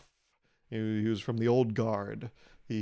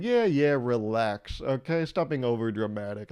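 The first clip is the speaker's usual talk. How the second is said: dismissive scolding; 3 dB louder than usual. Retold or imitated. Comic to microwave popping in it, loud dismissive scolding. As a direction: snap at the microwave like a fed-up parent. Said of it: "I even said that out loud".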